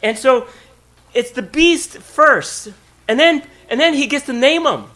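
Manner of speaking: loud, high preaching build on work; full-force preaching. Acted. Pastor preaching to the congregation about work, loud and high.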